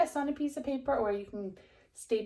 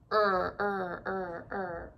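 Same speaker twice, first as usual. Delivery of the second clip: paddling sound; much slower than usual. Acted. Rowing sound in the story.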